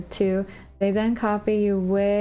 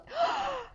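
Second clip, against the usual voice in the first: mock gasp; 7 dB quieter than usual. Mock hurt at boyfriend.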